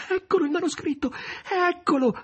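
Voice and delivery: tearful cry. Acted. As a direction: cry out, trembling with joy. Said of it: "tremare e gridò, commuovendosi di nuovo fino alle lacrime".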